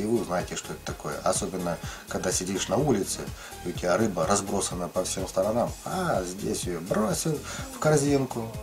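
ordinary delivery

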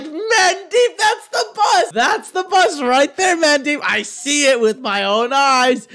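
giddy disbelief, can't stop laughing; thrilled yelp of discovery; excited laughing. Retold or imitated. Spotting the bus, laughing.

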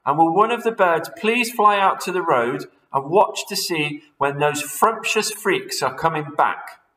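high, loud urgent instructions; rally the birds with orders; crying out orders. Acted. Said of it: "cried Mugglewump".

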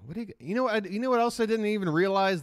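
usual speaking voice

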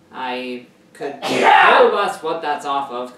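loud slow outburst; shouting. Real, not acted. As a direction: yell it out. Loud opening rant.

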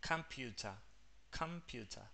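"computer" with schwas either side of long U, final R silent; two schwas. Shown as a demonstration. Teacher points out schwas to Rocío, "computer" with weak outer vowels. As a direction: softly model "computer" with weak first and last vowels. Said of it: "tenés dos sonidos de schwa".

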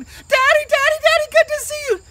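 put-on very high, loud kid squeal; mock child's gleeful shriek; excited children. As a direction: greet Dad like overjoyed little kids. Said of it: "his kids come running in".

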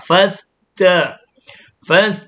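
"first" as "fas-ta", long hissed s before the t; breath finished before t. Shown as a demonstration. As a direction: finish the hiss in "first", then release the t separately. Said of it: "ให้ลมซีนเสร็จแล้วก็ไปตัวตา".

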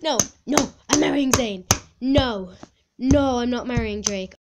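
loud, emphatic protest; firm, outraged refusal; indignant refusal. Real, not acted. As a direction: put your foot down loudly. Refuses the quiz result.

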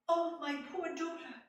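high, loud, anxious father; father alarmed for his daughter; worried father. Acted. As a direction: fret over his daughter.

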